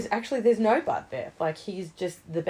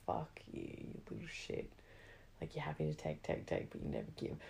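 hushed, much softer muttered insult; whispered inner rant; 13 semitones lower, 11 dB quieter than usual. Retold or imitated. Judging friends who never pay, hushed whisper. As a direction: mutter it under your breath.

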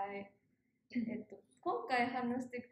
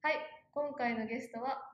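ordinary talk

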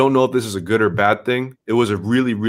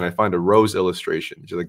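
usual speaking voice

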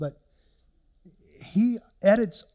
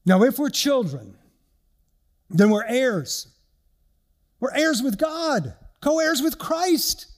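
louder, rising to a high proclaiming peak; emphatic reading; 6 semitones higher, 4 dB louder, slower than usual. Real, not acted. Preacher reading verse seventeen to the church, emphatic. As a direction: proclaim the verse with growing force. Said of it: "brings us to our last verse".

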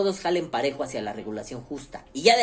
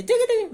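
high squeaky chirping noise; mock audio glitch squeak; squeaky tiqui tiqui; 5 dB louder, faster than usual. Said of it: "mi voz así, tiqui, tiqui".

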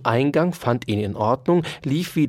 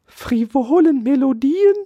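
high, loud, rising whine; wailing mouse; 14 semitones higher, 6 dB louder than usual. A narrator's reading. Mouse answers Dauerspeck's accusation, wailing. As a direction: wail it as a hurt question. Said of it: "jammerte die Maus".

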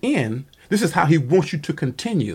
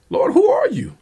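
much higher, bewildered cry; startled question; 3 dB louder than usual. Acted. Saul, knocked to the ground, asks the voice, startled high cry. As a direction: ask it like a man struck down and confused.

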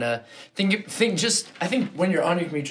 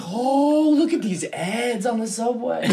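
mock tourist awe; 7 semitones higher, slower than usual. Mocking awestruck tourists on subway.

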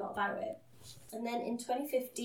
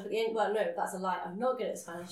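ordinary delivery

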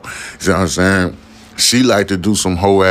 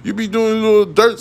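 retold comeback; 13 semitones higher than usual.

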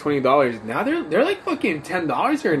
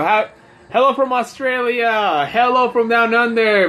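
mock Australian greeting; 8 semitones higher, 5 dB louder than usual.

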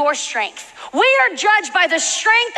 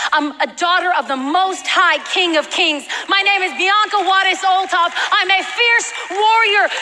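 higher-pitched, driving declaration; rousing proclamation of who you are; fervent preaching; 5 semitones higher than usual. Real, not acted. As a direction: preach it in a relentless, building run. Declaring identity in rising preaching.

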